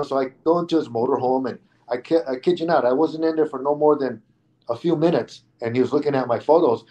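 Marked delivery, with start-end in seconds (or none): none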